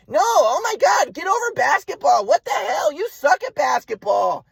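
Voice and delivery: high, drawn-out mock rant as mom; exasperated mother imitation. Mom refusing to move on, exasperated high mock rant. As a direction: mimic mom's high-pitched outburst, stretching key words. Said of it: "That's what she'd say".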